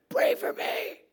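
harsh, forceful whispered croak; loud raspy begging; sick man's rasp. Acted. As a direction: push the plea out with no voice. Mute man repeats his plea.

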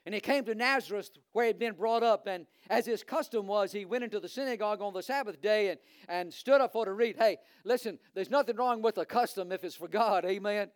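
ordinary talk

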